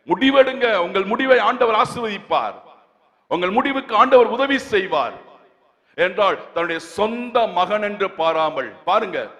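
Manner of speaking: ringing exhortation, stretched key words; forceful preaching. Acted. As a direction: drive each phrase home to the congregation.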